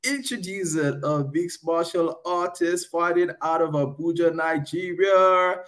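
high, stretched ring announcer intro; frantic mock sports commentary. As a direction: hype the fighter's entrance. Ring-style fighter introduction.

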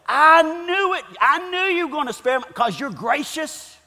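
hammed-up indignant grievance; mad at God. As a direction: throw each reason back as an accusation. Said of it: "Here's a guy mad".

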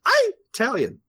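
high mocking "I'm Italian" as eye-TAL-yun; mock eye-talian. Mocking Illinois people, high mock eye-talian. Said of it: "you just wanna be like".

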